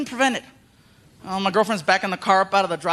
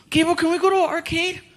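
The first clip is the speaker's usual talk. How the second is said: put-on kid voice; 8 semitones higher than usual.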